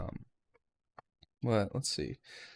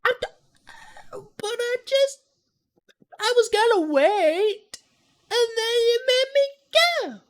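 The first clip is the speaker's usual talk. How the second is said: mock whiny self-quote; 7 dB louder than usual. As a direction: imitate your own petulant complaint in a squeaky high voice. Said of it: "And I was like".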